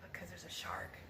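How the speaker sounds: hushed, voiceless whisper. A narrator's reading. Crew warning each other of a shark, whisper.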